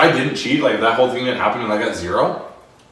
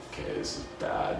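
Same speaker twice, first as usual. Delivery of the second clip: mock dread mutter; 13 semitones lower, 12 dB quieter than usual. Inner dread, retold.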